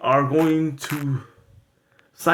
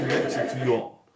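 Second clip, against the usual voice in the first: much lower mobster-boss voice; Tony Gambino voice; 7 semitones lower than usual. Acted. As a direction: drop the voice way down like a tough mob boss. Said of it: "my vocal tone goes down".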